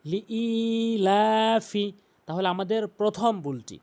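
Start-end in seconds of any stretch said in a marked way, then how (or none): 0.0-1.9 long held ই in লিইলাফি; correct recitation. Shown as a demonstration. Teacher models the first word for learners, long-vowelled recitation. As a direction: chant লিইলাফি slowly, drawing out the ই and লা. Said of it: "সঠিক উচ্চারণ হচ্ছে লিইলাফি".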